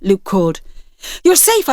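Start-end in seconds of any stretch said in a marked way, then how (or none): 1.2-1.7 high, breathless relief; relieved gasp. Acted. Mother reaching her son after danger: high relieved gasp. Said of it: "I gasped".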